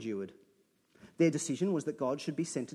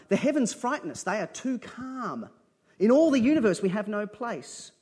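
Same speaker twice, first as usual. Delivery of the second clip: poem reading; 6 semitones higher, 7 dB louder than usual. Acted. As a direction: read the poem like a prayer rising.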